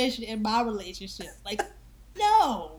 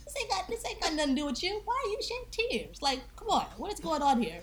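higher, half-laughing disbelief at his tears; exasperated scolding; 6 semitones higher than usual. Retold or imitated. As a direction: tell him off for crying with you. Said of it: "I'm like".

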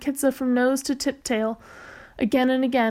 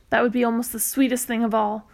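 plain reading voice

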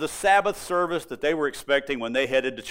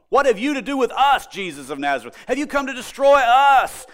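louder, higher retold challenge; demanding; 5 semitones higher, 5 dB louder than usual. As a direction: demand it as the accusing intruder, retold by a preacher. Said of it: "He was demanding, claiming".

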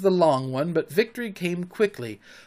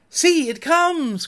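high, drawn-out call; slow, high exclamation; excited cry; 11 semitones higher, 4 dB louder, slower than usual. A narrator's reading. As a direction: call out the sighting, pitched way up. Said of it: "cried Curly".

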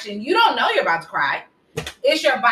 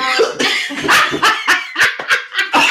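breathy laugh; 7 semitones higher, 4 dB louder than usual. Real, not acted. Laughing at own joke.

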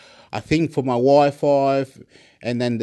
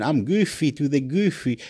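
normal talking voice

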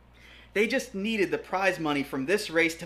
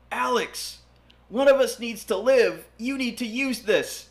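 desperate shout; 8 semitones higher, 4 dB louder than usual. Acted. Edward cries out while falling.

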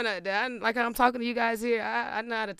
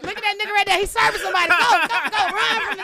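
high, loud urging; hammed-up high loud urging; excited mock cheering; 9 semitones higher, 7 dB louder, much faster than usual. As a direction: hype it up like girls chasing him off. Imitating girls telling him off.

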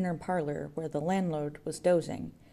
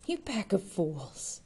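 slow contempt; 3 semitones higher, slower than usual. A narrator's reading. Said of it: "he cried".

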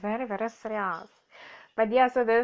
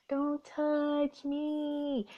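slow warning; 4 semitones higher, 3 dB quieter, much slower than usual. Acted. Telling taxi driver off.

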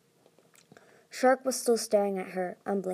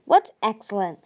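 demanding; 8 dB louder than usual. A narrator's reading. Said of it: "Tsunami demanded".

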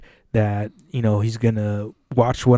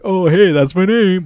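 higher, louder sarcastic "that's my name"; mock cheerful reply; 8 semitones higher, 6 dB louder than usual. Joking about turning round to the insult, hammed-up and sarcastic. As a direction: pretend chirpy answer. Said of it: "not to, like".

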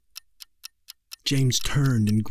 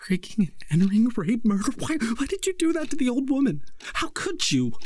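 accusing; 13 semitones higher, faster than usual. A narrator's reading. Mother accusing her son.

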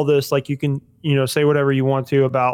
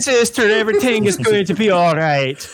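much higher and louder film line; Brad Pitt impression; 8 semitones higher, 3 dB louder than usual. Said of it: "my impression of, uh, Brad Pitt".

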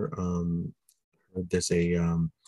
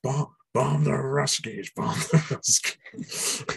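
high, slow growling chant; Animal voice; 7 semitones higher, slower than usual. Retold or imitated. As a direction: chant it like a wild drummer. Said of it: "you can just hear him go".

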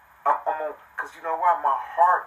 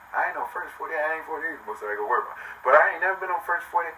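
ordinary talk

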